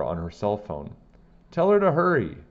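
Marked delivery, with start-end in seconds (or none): 1.5-2.4 high, loud yell from up a wall; yelling for help. A narrator's reading. Custodian stuck on a wall yells down to the crowd, loud and high. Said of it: "yelled down Mister Klutz".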